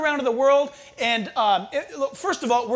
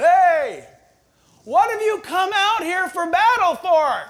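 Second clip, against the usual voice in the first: loud, high jeering call across the valley; mocking giant's taunt; 6 semitones higher, 6 dB louder, slower than usual. Goliath jeers at Israel's army across the valley, loud mocking call. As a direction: taunt them like a scornful giant.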